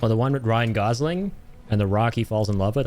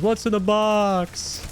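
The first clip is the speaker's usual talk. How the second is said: high, drawn-out hammed-up wail; movie quote; 11 semitones higher, much slower than usual. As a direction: parody an anguished film line, stretched out. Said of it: "where he goes".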